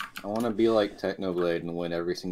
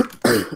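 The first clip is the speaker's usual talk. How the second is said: choking cough; 5 dB louder than usual. Real, not acted. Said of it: "you're still choking on cheese".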